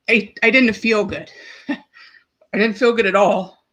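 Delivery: halting, with long pauses and a tearful laugh; tearing up. Real, not acted. Recalling the night of the first heart attack, tearing up. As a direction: push through the tears. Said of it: "I'm tearing up a little bit".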